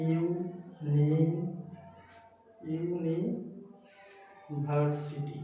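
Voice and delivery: slow university, pausing between its parts; split into syllables. Shown as a demonstration. Teacher working out the syllable count, university slowly broken up. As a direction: draw out university, "iu... ni... var-siti". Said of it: "কয়টা syllable".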